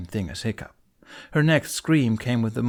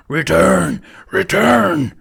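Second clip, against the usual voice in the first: much higher, much louder monster screech; screaming demon; 8 semitones higher, 11 dB louder, much slower than usual. A narrator's reading. Demon calling her fleet, screeching roar. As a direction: scream it to the clouds.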